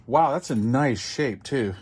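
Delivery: ordinary talk, nothing marked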